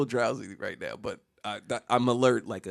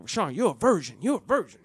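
aggressive taunting; 3 semitones higher than usual. Retold or imitated. Classmates teasing Rashawn about being a virgin, mock-aggressive taunting. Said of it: "they were mad aggressive".

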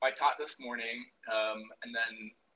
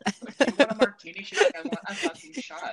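mock quote; 10 semitones higher, 10 dB louder than usual. Voicing host and friend.

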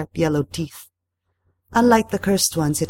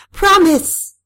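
high, loud shouted demand; explosive, commanding cry; 7 dB louder than usual. A narrator's reading. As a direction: shout it as an order. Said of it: "I shouted".

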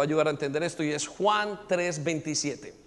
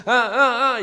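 loud, high mock baby wail; imitated baby cry; 5 semitones higher, 7 dB louder, slower than usual. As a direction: imitate a baby crying for food. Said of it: "comienza a llorar".

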